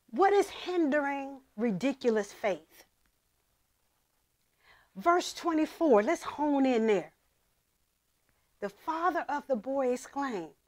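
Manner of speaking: usual speaking voice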